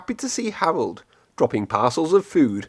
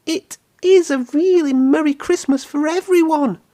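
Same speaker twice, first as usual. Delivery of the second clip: high, joyful engine voice; Percy the engine; 3 dB louder than usual. A narrator's reading. Percy on Christmas morning, high joyful engine voice. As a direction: beam it out to all his friends. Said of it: "said Percy".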